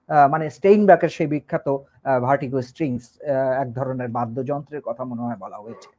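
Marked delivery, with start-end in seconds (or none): none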